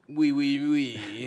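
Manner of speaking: loud, hammed-up French accent; mock French. Mocking the French fan's letter, bad French accent. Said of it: "bad French accent".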